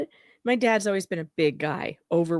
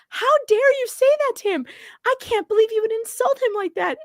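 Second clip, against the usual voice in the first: high and fast, imitating indignation; mock scolding rush; retold outrage; 12 semitones higher than usual. Scolding dad for insulting her friend, mock indignant. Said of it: "we were like".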